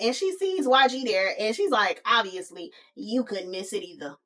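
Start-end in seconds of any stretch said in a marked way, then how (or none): 2.1-4.2 catty aside. Retold or imitated. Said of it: "That's what she wanted to say".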